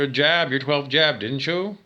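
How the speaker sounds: normal talking voice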